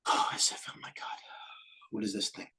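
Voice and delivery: breathless whisper of disbelief; awed whisper. Real, not acted. Trader whispering at a shocking chart, awed. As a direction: whisper it like you can't believe the chart.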